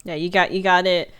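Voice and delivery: normal talking voice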